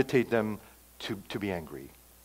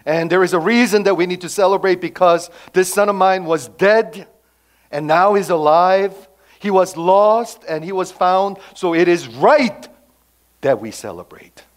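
loud, higher, festive call to celebrate; joyful father; 7 semitones higher, 10 dB louder than usual. Acted. Father calling the household to celebrate, joyful and loud. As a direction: throw open the party with delight. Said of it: "So he throws a party".

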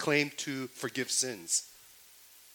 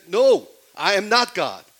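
loud, slow, high refusal; a loud, slow, insistent protest; emphatic denial; 9 semitones higher, 15 dB louder than usual. Acted. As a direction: deny it with full force. Paul and Silas refusing worship.

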